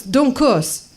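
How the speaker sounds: high scolding order, repeated; security guard. Acted. Guard reprimanding traveller, high scolding voice. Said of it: "He's like".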